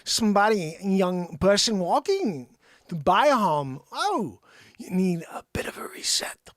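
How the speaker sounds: slow Walken drawl with odd pauses; Christopher Walken impression. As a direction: do a halting Walken, breaking words oddly. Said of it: "what if Christopher Walken came along".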